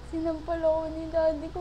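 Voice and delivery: ordinary talk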